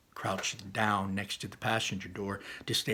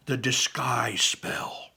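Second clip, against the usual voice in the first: slow, whispery, drawn-out reveal; awed reveal; 4 dB louder, much slower than usual. Acted. Barley revealing the spell idea, hushed awe. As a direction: unveil it slowly in a stage whisper.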